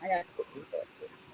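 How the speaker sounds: fast whispered apology aside; hushed, hurried excuse. Real, not acted. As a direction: breathe it quickly under your breath. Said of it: "oh, sorry, my hand's too quick".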